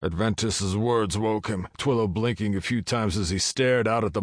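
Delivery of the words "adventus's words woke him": usual speaking voice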